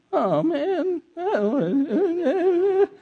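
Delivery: put-on very high, drawn-out babyish whine; exaggerated whine. Shown as a demonstration. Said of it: "say it with that kind of tone".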